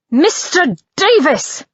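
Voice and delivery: sharp, slow scolding call; exasperated. A narrator's reading. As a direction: scold him, fed up. Exasperated rebuke.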